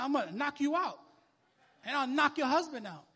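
a hint of furious threat, rising; retold threat. Preacher retelling how he threatened the lady. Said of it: "I just threaten her".

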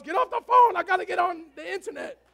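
high shout from across the house; yelling sibling. Retold or imitated. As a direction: yell like an annoyed sibling. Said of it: "everybody's screaming in your house".

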